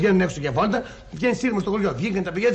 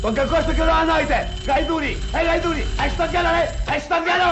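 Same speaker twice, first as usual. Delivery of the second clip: raised-pitch comic tirade; angry insults; 10 semitones higher than usual. Acted. Mitsos curses the driver blocking the road, angrily. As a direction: hurl curses in a high, agitated voice. Furious row out of a window.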